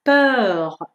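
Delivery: peur with a long rounded vowel between o and e; drawn-out French eu. Shown as a demonstration. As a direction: stretch the eu in peur, then a French r. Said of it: "یکم این دفعه کشیده تر از ژ".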